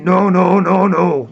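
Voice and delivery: put-on African accent. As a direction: mimic an African accent with rounded vowels. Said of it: "like using an African accent".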